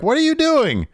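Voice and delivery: very high, loud protest; mock exasperation. Wrestler to costumed rival, high mock exasperation. Voices annoyed wrestler in argument.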